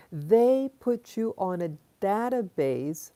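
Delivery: deliberate, drawn-out words for weight; slow, emphatic repeat. Real, not acted. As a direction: spell it out slowly for them. Repeats the line slowly for emphasis.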